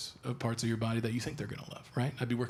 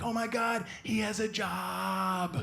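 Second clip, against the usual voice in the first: high, drawn-out mock squeal; imitated girlish gushing; 6 semitones higher, much slower than usual. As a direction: gush like a girlfriend spotting a cute dog. Imitates girlfriend gushing at dogs.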